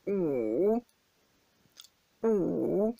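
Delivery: "ou" very low, dipping then rising; third tone wu. Shown as a demonstration. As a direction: sink "ou" low, then lift it. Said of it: "la voix la plus grave que vous puissiez faire".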